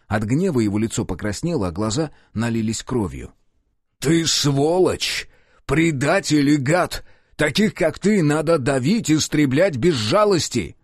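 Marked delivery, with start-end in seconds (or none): 4.0-10.8 slow, higher, louder furious shouting; enraged tirade. A narrator's reading. Enraged detective berating the traitor, furious shouting. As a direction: hurl every insult. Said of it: "таким разъярённым".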